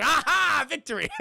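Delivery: high, loud victorious crow; mock triumph. Streamer jokes the imposter was found, mock-triumphant. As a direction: crow it like a game winner. Mock game win announcement while laughing.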